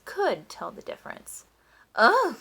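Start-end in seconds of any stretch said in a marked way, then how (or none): 1.9-2.4 high, loud groan of disgust; disgusted ugh. A narrator's reading. Duchess tasting the watery roll, disgusted groan. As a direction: spit out a revolted ugh. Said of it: "said the Duchess after she took a bite".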